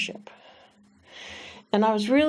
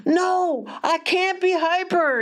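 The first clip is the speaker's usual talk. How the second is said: put-on little boy; 10 semitones higher, slower than usual. Boy voiced in retold story.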